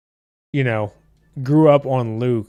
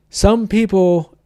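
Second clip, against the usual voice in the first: "some people" stretched slowly, much higher; "some people" pulled long and high, mocking the critics; drawn-out high repeat; 7 semitones higher, slower than usual. Shown as a demonstration. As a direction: draw out "some people" up high and slow, teasing.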